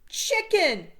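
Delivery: loud high shout. A narrator's reading. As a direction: yell at the charging bird. Fred shouts in fear.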